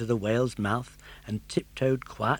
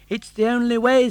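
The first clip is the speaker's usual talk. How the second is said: put-on high, bright puppet boy voice; a determined young puppet, raised pitch; Pinocchio; 11 semitones higher, 9 dB louder than usual. Puppet boy urging his father, high and eager.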